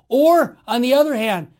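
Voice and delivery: ordinary delivery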